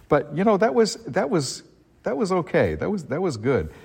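high, light, self-mocking shrug; amused high voice. Real, not acted. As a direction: pitch it way up, wryly. Brushing off his small part.

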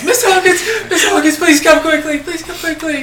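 put-on high, whining seven-year-old pleading; tearful little boy. Timmy in tears begging Miss Hawkins for help, high whiny boy. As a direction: beg for help as a crying child. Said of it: "a little seven-year-old boy".

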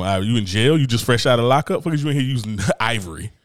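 ordinary talk, nothing marked

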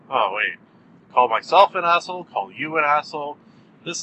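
usual speaking voice

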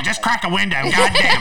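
high, loud exasperated mom; annoyed mom yell. Retold or imitated. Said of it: "my mom was like".

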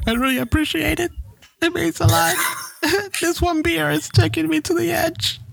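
high, hammed-up teary drunk voice; mock weepy drunk thanks; drunk crying. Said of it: "I forgot Jose's a weepy drunk".

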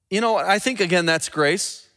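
ordinary talk

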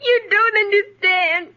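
put-on tearful child's protest; crying. Girl accused of lying to her stepmother, crying. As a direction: wail it through sobs. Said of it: "what are you crying about".

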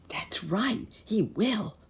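high, anxious stage whisper. A narrator's reading. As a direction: confide it in a high, audible whisper. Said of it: "whispered Nurse Jane".

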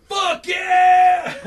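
high loud shout. Acted. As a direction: yell it out big. American climax shout.

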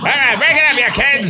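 high, loud teacher breaking up a fight; stern teacher. Retold or imitated. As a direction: bark like a gym teacher. Echoes teacher in clip.

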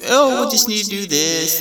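mocking stalling family. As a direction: brush off the kid. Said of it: "they're like".

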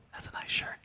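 unvoiced whispered aside. Leaning over to Kelsey in the cinema, whispering. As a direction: whisper it to the person beside you. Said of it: "I kept leaning over to Kelsey like".

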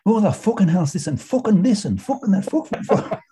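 high-pitched mock Irish swearing, rattled off; Irish accent. Client imitating Irish owner's swearing to cohost, high Irish accent. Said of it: "in his Irish accent".

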